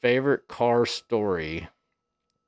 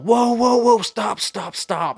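high, loud, urgent warning yell; retold yell; 11 semitones higher, 3 dB louder, slower than usual. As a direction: re-enact yelling at the driver to halt. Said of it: "I start yelling".

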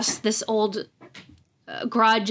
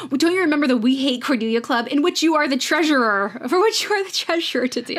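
mock indignant, pitch climbing higher; upset retelling; 4 semitones higher than usual. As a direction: imitate someone upset, getting more worked up as it goes. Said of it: "she's very upset".

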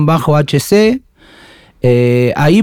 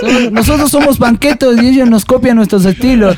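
higher mock punk quote; imitating the punk; 8 semitones higher than usual. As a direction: retell his line with a grin. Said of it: "¿Cómo es que dice?".

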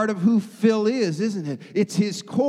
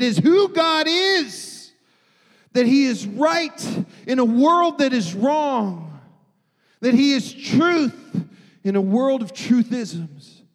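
preaching at full force; 4 semitones higher, 3 dB louder than usual. Acted. Preaching climax on God's focus.

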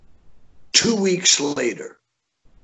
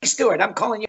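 higher, breezy impression of the caller; lightly voiced showbiz boss calling; a hint of the friendly promoter on the phone; Jon Stewart style; 8 semitones higher than usual. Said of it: "in typical Jon Stewart style".

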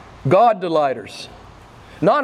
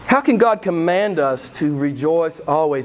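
ordinary talk

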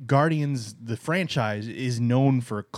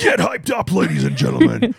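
monster truck ad; 7 dB louder than usual. Pretend. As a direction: shout it like an arena hype man. Mock monster truck announcer.

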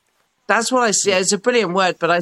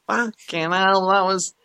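mock dismay; slower than usual. Guilt after heartfelt apology.

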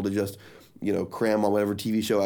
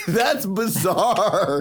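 laughing; 8 semitones higher, 3 dB louder, much slower than usual. Real, not acted. Laughing reaction.

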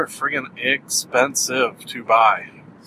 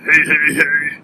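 Fat Albert impression; 2 semitones higher, 4 dB louder than usual. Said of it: "Fat Albert there".

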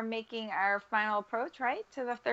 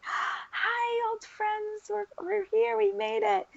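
much higher, giddy wave to the booth; excited runner hailing the announcers, much higher; excited greeting; 13 semitones higher, much slower than usual. Retold or imitated. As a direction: call out like spotting old friends. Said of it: "you know, like".

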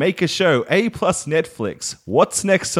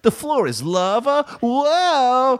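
high, delighted "lava, whoa"; charming whoa; 12 semitones higher, much slower than usual. Shown as a demonstration. Showing the charming way to read his tweet, gushing. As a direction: gush with childlike wonder. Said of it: "if you say it like I said".

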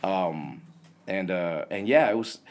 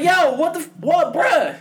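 high, loud, outraged yelp of disbelief; 7 dB louder than usual. Retold or imitated. Retelling how he felt when they cut wood shop, outraged disbelief. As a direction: squawk it like you just got robbed. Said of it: "I was like".

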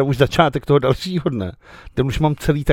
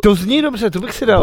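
excited; 9 semitones higher, 6 dB louder than usual. Real, not acted. Nadšená reakce na kyseláč.

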